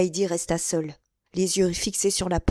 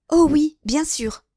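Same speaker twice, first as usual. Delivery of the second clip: high, louder, slow insistence; no doubt at all; emphatic; 8 semitones higher, 7 dB louder, slower than usual. A narrator's reading. Young wife insists to milady it is not him, emphatic. Certain denial, excited.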